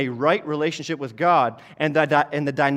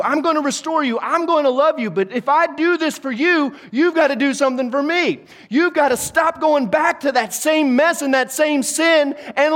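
raised, high-pitched preacher's exhortation; emphatic preaching; 7 semitones higher, 4 dB louder than usual. Acted. Preacher urging people not to sin again, emphatic preaching. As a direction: preach it forcefully, well above your talking pitch.

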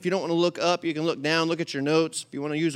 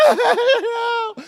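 laughing; 6 dB louder than usual. Real, not acted. Laughing at the lighters memory.

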